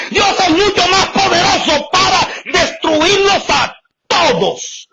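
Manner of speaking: shouted high preacher cadence with tearful aleluyas; fiery preaching. Acted. Preacher crying out to the congregation, fervent shout. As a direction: preach it out with full fervour.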